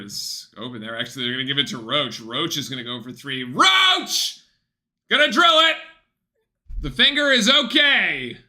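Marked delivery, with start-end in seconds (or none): 3.4-8.4 loud, high yelling at the TV; excited shouting. Real, not acted. Fan roaring at the TV as Roach drills a three, shouting. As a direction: cheer the shot like you're on your feet. Cheering a Duke three-pointer.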